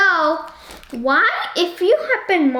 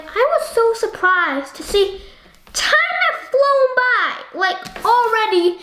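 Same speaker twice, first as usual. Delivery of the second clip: surprised; 8 semitones higher than usual. Real, not acted. Surprised at time passing.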